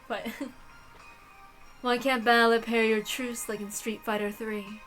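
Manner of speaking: normal talking voice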